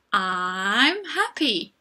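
put-on slow, clear model sentence for kids; slow model answer. Shown as a demonstration. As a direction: say it slowly so pupils can copy. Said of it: "Can you say, "I'm happy"?".

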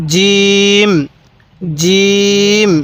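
firm "j", then a long level "eem" in জিম; hard জিম. Shown as a demonstration. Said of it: "জিমটা আমরা শক্ত করে উচ্চারণ করব".

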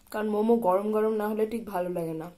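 chewing between words; eating while talking; mouth full. Real, not acted. Seen 0: Bites food at 2.1, mouth working while speaking after.

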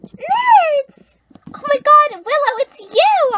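high, loud squealed hello; excited greeting. Acted. Friends meeting at the sleepover, high excited squeal.

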